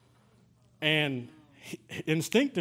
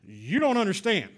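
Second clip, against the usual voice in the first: insistent doctor; 6 semitones higher, 5 dB louder than usual. Retold or imitated. Doctor insists on grave diagnosis.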